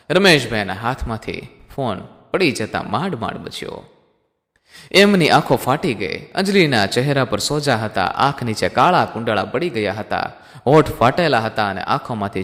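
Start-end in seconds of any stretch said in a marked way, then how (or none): none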